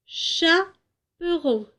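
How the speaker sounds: Chaperon as cha... peron, slow and high; first syllable isolated. Shown as a demonstration. Teacher comparing two picture words, Chaperon split after cha. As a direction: set off the cha of Chaperon with a pause. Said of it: "commencent par la syllabe chat".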